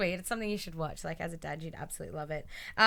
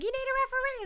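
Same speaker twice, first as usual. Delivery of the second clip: mock call; faster than usual. Carmella calling for referee.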